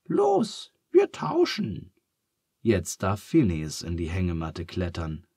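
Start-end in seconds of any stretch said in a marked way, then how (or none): 0.1-1.9 much higher, brisk call; Charles brisk. A narrator's reading. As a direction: jump up and urge. Charles leaps out, decisive. (Charles fordert entschlossen und hoch)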